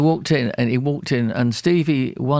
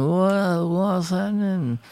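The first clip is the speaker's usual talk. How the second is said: higher, slow, drawn-out puzzled voice with the pitch raised well up; 7 semitones higher, much slower than usual. Retold or imitated. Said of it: "a terrible impression of [name]".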